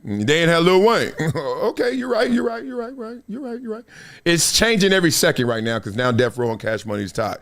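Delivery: ordinary delivery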